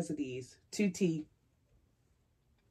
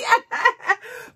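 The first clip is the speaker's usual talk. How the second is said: tearful laugh; 12 semitones higher, 6 dB louder than usual. Real, not acted. Laughing while crying.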